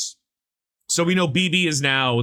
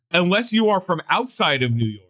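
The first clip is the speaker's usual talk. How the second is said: ordinary delivery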